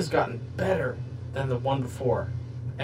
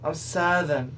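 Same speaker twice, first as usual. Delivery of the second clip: mock Southern accent; 4 semitones higher than usual. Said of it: "and she's like".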